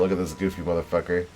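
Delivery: ordinary delivery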